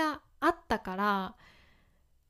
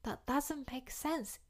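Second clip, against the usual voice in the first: slow, soft example; 3 semitones higher, 8 dB quieter, much slower than usual. Shown as a demonstration. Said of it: "みたいな感じ".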